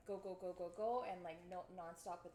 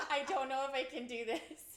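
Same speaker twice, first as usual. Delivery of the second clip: laughing; 5 semitones higher, 11 dB louder than usual. Retold or imitated. Sister quoted, laughing.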